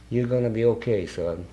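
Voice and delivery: soft, low reassurance; gently steady someone who is upset, voice dropping; retold comforting. Woman with hand on newcomer's shoulder, retold soft comforting.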